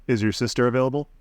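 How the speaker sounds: normal talking voice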